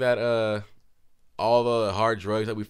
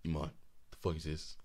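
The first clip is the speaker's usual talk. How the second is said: low, much softer, a hint of cold anger; quiet menacing dad; 8 semitones lower, 14 dB quieter than usual. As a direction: drop your voice down, dangerously quiet. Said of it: "He goes like this".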